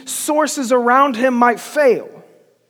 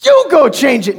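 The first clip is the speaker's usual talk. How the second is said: louder comeback, hitting "You" high; mock defiant retort; 3 semitones higher, 5 dB louder than usual. Teenager imagines answering dad back, loud mock defiance. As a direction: pretend outburst, throwing the order back.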